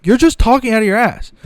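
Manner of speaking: normal talking voice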